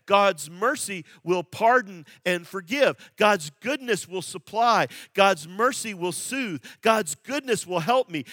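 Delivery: booming pulpit declaration; fervent preaching. Acted. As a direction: proclaim each promise with fire. Preaching at full force.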